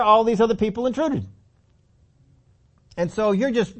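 ordinary delivery

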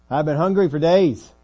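high, loud cry of hardship; full-voiced preaching; impassioned testimony. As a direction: proclaim the suffering with force. Preacher voices Paul listing hardships.

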